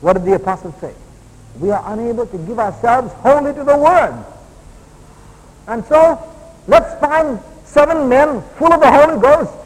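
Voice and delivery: loud, high-pitched preacher delivery; emphatic preaching. Acted. Preaches at full force.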